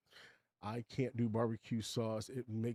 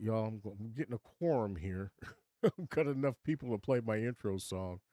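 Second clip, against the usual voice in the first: usual speaking voice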